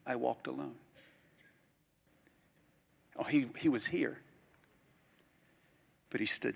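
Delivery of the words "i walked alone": dropped to a hushed, soft repeat; softer repeat. Shown as a demonstration. Preacher repeating to the church, hushed and soft. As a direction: say it again quietly, letting it sink in. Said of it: "Let me say it again".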